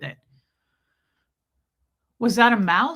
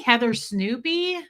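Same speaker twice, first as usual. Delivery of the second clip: ordinary delivery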